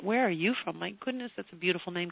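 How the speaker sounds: ordinary talk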